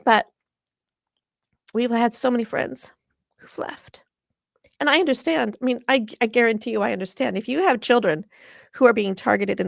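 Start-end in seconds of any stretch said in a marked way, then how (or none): 3.4-4.0 choked up. Real, not acted. Activist mourning friends who have left, choked up. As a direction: voice fading to a tearful whisper. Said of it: "I'm gonna get so emotional".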